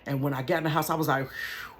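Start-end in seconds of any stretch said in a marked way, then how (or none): 1.3-1.7 relief sigh. Retold or imitated. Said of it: "I just sat there stunned".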